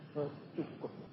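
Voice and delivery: secukupnya breathed soft and unvoiced; preacher echoing the key word softly; whispered emphasis.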